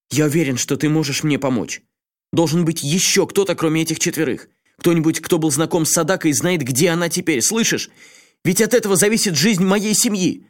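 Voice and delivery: high, agitated desperate man; pleading in rising panic; взволнованный Асакава. A narrator's reading. Асакава умоляет друга помочь. (взволнованный, отчаянный голос)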